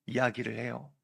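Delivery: ordinary talk, nothing marked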